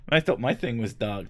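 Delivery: ordinary talk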